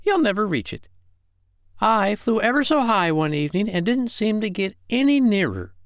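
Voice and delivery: high-pitched little bird voice; exclaiming wren. Acted. Miss Wren tells Willie the moon is unreachable, exclaiming. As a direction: exclaim it high, all the way through. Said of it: "cried Miss Wren".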